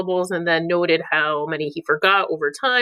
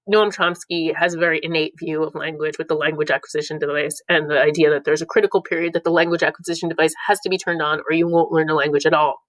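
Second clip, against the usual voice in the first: normal talking voice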